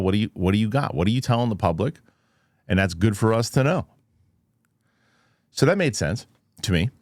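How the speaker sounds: usual speaking voice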